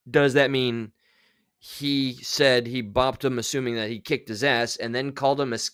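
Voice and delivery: ordinary delivery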